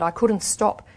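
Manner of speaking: ordinary delivery, nothing marked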